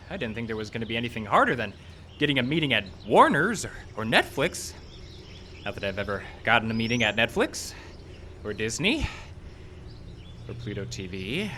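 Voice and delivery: normal talking voice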